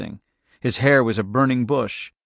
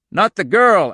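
higher, louder cry to halt it; alarmed shout; 8 semitones higher, 7 dB louder than usual. A narrator's reading. Al to the men as guns go off, shouted. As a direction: shout it out urgently. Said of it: "Al shouted".

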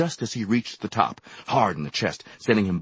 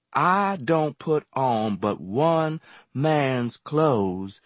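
slow, sassy drawl; lazy sulky drawl; 6 semitones higher, slower than usual. A narrator's reading. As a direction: take your time, she won't be hurried. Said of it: "she drawled".

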